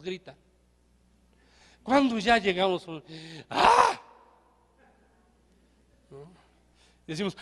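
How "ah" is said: sudden high yelp of a shout; mock yell. Pastor shows the congregation how even meek men yell, with one short mock yell. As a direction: let out one sharp fake yell. Illustrating how everyone yells.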